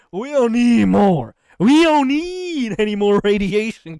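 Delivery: loud slow declaration. Acted. Earth telling the sun to keep its radiation away, a loud slow rant. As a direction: plant your feet and tell the sun off.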